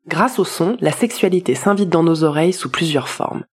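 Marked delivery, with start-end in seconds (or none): none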